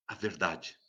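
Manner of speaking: soft answer. Acted. Preacher to listeners, answering softly. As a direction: let the answer drop quietly.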